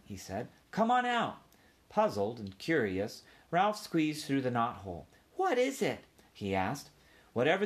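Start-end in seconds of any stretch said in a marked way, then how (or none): 0.7-1.4 put-on high boy's voice; eager kid calling a small friend; coaxing boy. A narrator's reading. Boy coaxes mouse out. 5.4-6.0 high, puzzled mouse voice; small curious creature; curious young mouse. A narrator's reading.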